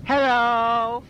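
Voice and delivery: put-on very high squeaky toddler creature; high baby-talk Teletubby; Tinky Winky. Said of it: "I will be the great Teletubby Tinky Winky".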